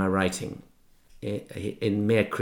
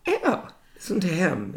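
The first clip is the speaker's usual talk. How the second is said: mocking English schoolboys; 9 semitones higher than usual. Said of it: "they were like".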